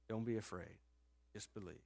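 soft, low and gentle; calm a frightened father; reassuring. Retold or imitated.

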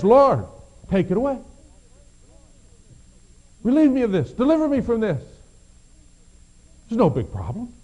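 Paul begging God to take his weakness away; pleading prayer. Acted. Paul begs God, loud and pleading. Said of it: "And so the apostle Paul prays".

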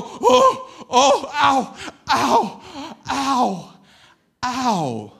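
loud hammed-up ows, laughing; mock pained cries. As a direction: yelp like you're being tumbled. Tumbling back in the wave.

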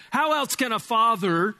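ordinary talk, nothing marked

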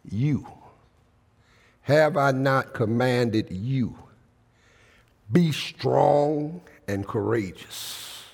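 slow, drawn-out preaching of the verse; solemn scripture reading. Acted. Preacher reading Joshua to the congregation, slow and solemn. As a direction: lay each phrase down slowly.

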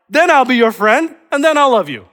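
high, loud bargaining promise; hammed-up conditional offer; imagined conditional Jesus. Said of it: "Notice what Jesus does not say".

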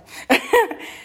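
high, breathy laugh through tears; embarrassed laugh. Real, not acted. Leader laughing off her tears in front of the youth group. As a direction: laugh it off after tearing up.

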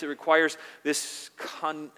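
ordinary delivery

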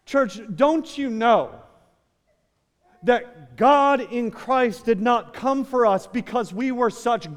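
fervent pulpit challenge; impassioned preaching. Real, not acted. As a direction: preach it as a pressing rhetorical question. Preaches at full force.

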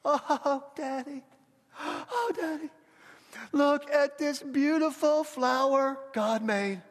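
put-on high, slow little-girl voice; imitated little daughter. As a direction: gush in wonder as a small child, voice lifted high. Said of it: "This is her. She goes".

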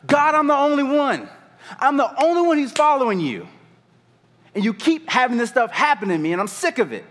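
loud, high-pitched outcry to God; desperate complaint. Acted. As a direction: wail it out like a tantrum. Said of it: "he sounded".

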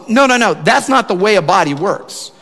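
a lightly voiced apostle correcting firmly; Paul voiced. Pastor voicing Paul correcting the church, emphatic. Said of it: "the Apostle Paul goes".

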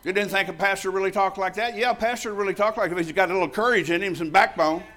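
loud, high and pumped up; excited. Real, not acted. Pastor answering his cheering congregation, loud and excited.

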